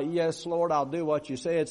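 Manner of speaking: ordinary talk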